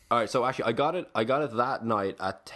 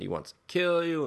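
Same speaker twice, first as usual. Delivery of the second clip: higher, mock-distraught pitch; retold hysterical crying; 8 semitones higher than usual. Woman warning him about her husband, mock hysterical. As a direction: imitate her upset, without real sobs. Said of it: "she's, like, hysterically crying".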